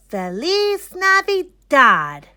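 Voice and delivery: high, loud, slow Spanish greeting; festive greeting. A narrator's reading. Moises greets the class in Spanish, joyful. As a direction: ring it out like a holiday cheer. Holiday greeting, first take.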